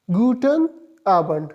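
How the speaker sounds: slow, careful Guten Abend, GOO-ten AH-bent; German pronunciation demo. Shown as a demonstration. Teacher shows students how to say it, slow and careful. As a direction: spell out guten Abend slowly, syllable by syllable. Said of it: "इसको pronounce किस तरह कर देंगे".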